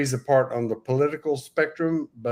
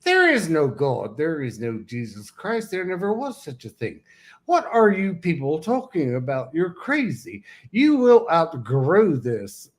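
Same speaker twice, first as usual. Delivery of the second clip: lightly voiced Pennsylvania accent, blunt and dismissive; a mild Pennsylvania accent doing the famous atheist; O'Hair impersonation; 3 semitones higher than usual. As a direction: scoff at believers in a Pennsylvania accent. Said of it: "my impersonation of her".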